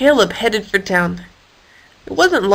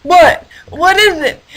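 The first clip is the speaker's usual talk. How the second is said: startled awake; 8 semitones higher, 4 dB louder than usual. Acted. Hippo woken abruptly.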